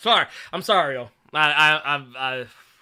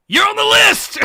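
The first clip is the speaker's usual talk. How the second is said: high, much louder yelled catchphrase; catchphrase shout; 14 semitones higher, 12 dB louder than usual. Pretend. Host yelling the wrestler's catchphrase at the chat, loud. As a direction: bellow it like a wrestler on the mic.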